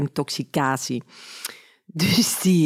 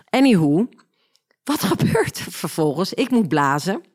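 ordinary talk, nothing marked

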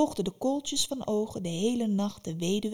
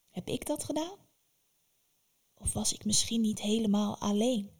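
wondering whisper. Acted. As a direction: breathe the question in awe. Wondering alone at night.

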